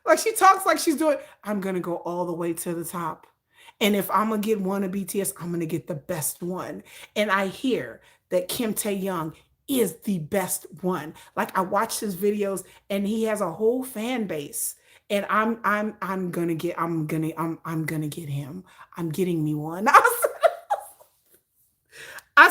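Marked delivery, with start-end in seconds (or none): 1.4-17.3 low, soft, serious deadpan; low serious voice of a woman set on a pop star; imitating her matter-of-fact talk. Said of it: "the way she talks is like this". 17.4-18.6 whisper. Retold or imitated. Whispered within imitation. 19.0-19.9 low, soft, serious deadpan; low serious voice of a woman set on a pop star; imitating her matter-of-fact talk. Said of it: "the way she talks is like this".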